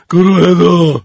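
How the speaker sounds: mild mock yell of a teacher; a hint of a stern shout. Teacher calls out group two, mild mock yell. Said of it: "خانم معلم داد زد".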